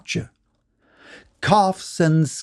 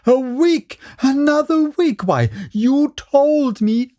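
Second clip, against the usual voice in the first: much higher, furious outburst; enraged shout; 13 semitones higher than usual. A narrator's reading. George raging at the old man's extra week. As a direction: shout it, beside yourself with rage. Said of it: "shouted Mister Wright, almost beside himself with rage".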